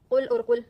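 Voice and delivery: कुल and कुल said loud, contrasting the vowel; कुल बनाम कुल; two pronunciations. Shown as a demonstration. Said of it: "कुल को कुल पढ़ना".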